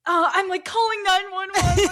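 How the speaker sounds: high, loud pretend emergency freak-out; mock panic. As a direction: play overreacting to a fake crisis, laughing. Imagines panicking for real during larp.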